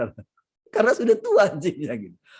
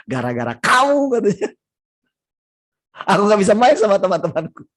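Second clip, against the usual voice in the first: frustrated outburst; 3 semitones higher, 7 dB louder than usual. Acted. David blames his sheep.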